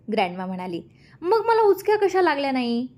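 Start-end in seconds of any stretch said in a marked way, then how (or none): 1.2-2.9 high, hammed-up puzzled voice; mock pondering. A narrator's reading. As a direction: pretend to puzzle it over. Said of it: "विचार करण्याचं नाटक करत".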